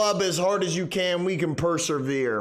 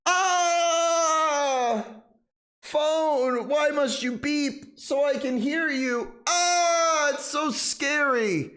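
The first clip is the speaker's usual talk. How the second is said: high, whiny mock-terrified wailing; hammed-up horror panic, higher pitched; 7 semitones higher, slower than usual. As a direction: wail as if the phone is eating your fingers. Phone jump-scare panic.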